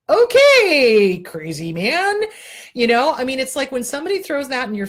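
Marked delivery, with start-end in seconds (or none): 0.1-2.2 mock agreement, dripping sarcasm; 1 sarcastic okay. Real, not acted. Sarcastic retort to dad's words.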